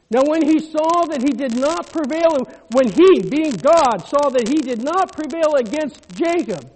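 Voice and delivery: loud, high preacher's cry; full-force preaching. Real, not acted. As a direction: preach it out to the congregation.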